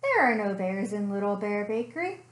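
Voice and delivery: ordinary delivery, nothing marked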